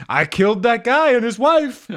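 high loud mock voice; put-on caricature voice. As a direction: keep the silly boast voice going. Bit continues.

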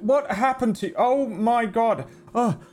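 high, alarmed fussing; panicked concern. Acted. Poncho man checking over the child, high and alarmed.

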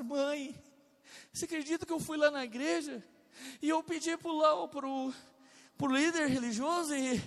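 retold indignant daughter, pitched high. Daughter telling her mother about church, indignant. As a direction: voice an affronted daughter complaining to mum. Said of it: "Ela foi indignada pra casa".

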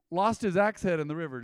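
ordinary delivery

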